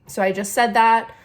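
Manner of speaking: usual speaking voice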